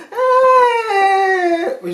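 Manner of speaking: high hammed-up crying wail; mock crying wail. Vlogger fakes crying while telling his injury story. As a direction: fake a loud crying wail. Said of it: "j'ai mis très bien la personne qui pleure".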